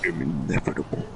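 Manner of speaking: low, fast menacing villain voice; Thanos impression. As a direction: growl it like a doomsday tyrant. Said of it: "stop trying to do Thanos impressions".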